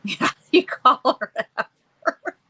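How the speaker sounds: slow and loud, words through real laughter; laughing. Real, not acted. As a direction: talk while still laughing. Laughing with viewer comment.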